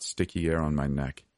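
ordinary delivery

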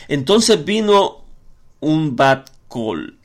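slow, weighty stress on "Bat Kol", each syllable drawn out; slow emphasis. Real, not acted. Pastor revealing the heavenly voice to the congregation, slow and emphatic. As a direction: lean slowly into "un Bat Kol" as the key reveal.

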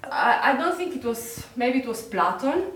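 talking on through real tears; crying. Real, not acted. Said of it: "Sorry, I'm crying".

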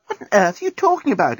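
can't believe what she hears; exclaiming. Acted. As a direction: friend baffled by Lucy's decision, exclaiming. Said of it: "exclaimed Nettie".

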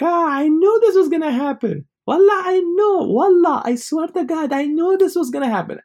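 high, loud gloating uncle; laughing uncle voice. Retold or imitated.

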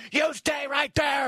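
high, slow, drawn-out screamed order; screaming. Acted. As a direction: scream it to freeze an intruder. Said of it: "I will start screaming".

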